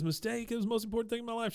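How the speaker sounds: fast, high whiny retelling of the wail; toned-down mock bawling. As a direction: rush it in a high boyish voice. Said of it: "he says".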